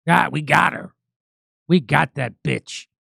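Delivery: low, slowed-down mock triumph; mock gloating. Host voices critics who got the actress dropped, mock gloating. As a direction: savor it like a villain who caught the prey.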